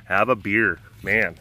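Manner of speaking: ordinary delivery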